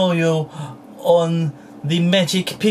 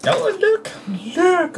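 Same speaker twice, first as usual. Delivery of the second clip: excited look; 13 semitones higher, slower than usual. Real, not acted. Showing toy excitedly.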